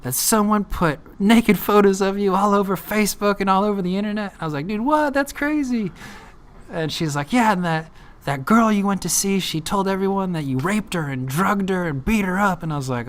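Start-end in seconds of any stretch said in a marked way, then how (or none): 0.0-4.3 high-pitched, urgent news-breaking; imitating an agitated friend on a call; worked-up friend. Friend acted breaking shocking news. 4.7-5.9 very high, incredulous reaction; imitated shocked reply; acted disbelief. Acts own shocked reaction. 7.3-12.6 high-pitched, urgent news-breaking; imitating an agitated friend on a call; worked-up friend. Friend acted relaying accusations.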